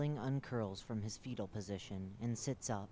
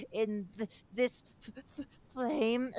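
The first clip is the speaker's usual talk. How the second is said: high, loud, coughing, stammering plea; agonized creature; 13 semitones higher, 11 dB louder, slower than usual. Acted. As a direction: gasp through the burning pain. Creature in agony begs.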